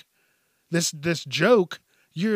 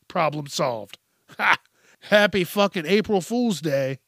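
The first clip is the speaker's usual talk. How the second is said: normal talking voice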